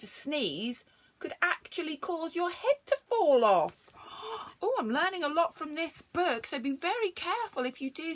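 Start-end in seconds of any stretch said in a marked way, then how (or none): 4.1-4.6 sharp gasp. Real, not acted. Reacts to the story.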